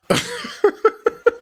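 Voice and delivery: high-pitched staccato laugh; mocking laughter. Real, not acted. Reactor laughing at her mac sauce discovery, high cackle. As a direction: burst into a high, rattling cackle.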